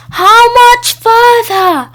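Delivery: high, loud, slow call from up a tree. A narrator's reading. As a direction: shout down without looking. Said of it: "she called down".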